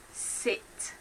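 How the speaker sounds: "Sit" whispered with a short clipped i; whispered demo word. Shown as a demonstration. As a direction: breathe "Sit" softly, short vowel. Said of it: "to make eh".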